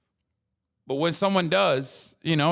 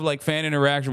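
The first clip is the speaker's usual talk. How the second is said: normal talking voice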